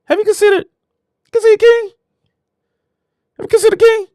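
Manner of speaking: very high, light voice of God; lofty falsetto question. Acted. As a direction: play God asking in a high, airy voice. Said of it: "God might be like".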